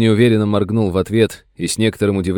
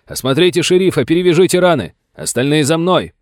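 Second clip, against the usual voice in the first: much higher, loud commands; shouted orders; 8 semitones higher, 4 dB louder than usual. Acted. Sam ordering the soldiers behind him, shouting. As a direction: drive the men on. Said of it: "крикнул догонявшим".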